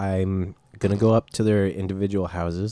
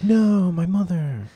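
ghost voice; 10 semitones higher, 3 dB louder, slower than usual. Retold or imitated. Voicing a ghost kid.

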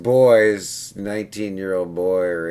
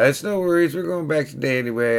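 a lightly higher, easygoing client voice; breezy client imitation; 7 semitones higher, faster than usual. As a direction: keep it casual and carefree. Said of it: "And, uh".

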